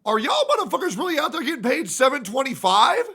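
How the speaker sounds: high, fast disbelieving question; incredulous. Real, not acted. Incredulous at the low wage.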